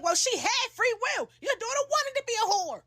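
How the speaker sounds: high-pitched taunting comeback; sassy retort. Retold or imitated. Her sassy comeback to father.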